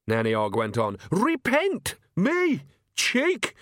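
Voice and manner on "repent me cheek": very high, indignant squeaks; outraged disbelief. Acted. Nanny outraged at a leaflet, high indignant squeaks. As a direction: squeak out each word in mock outrage.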